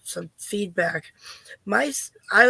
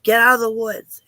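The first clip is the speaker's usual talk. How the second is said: louder, sharp mother's call; scolding mom; 3 semitones higher, 7 dB louder than usual. Retold or imitated. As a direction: order him out of the woods like a mad mom. Mother scolding son in woods.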